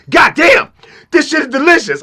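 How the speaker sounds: high, loud exclamation of delight; amazed shout. Retold or imitated. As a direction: shout it like the taste blew your mind. [name] tasting the orange juice.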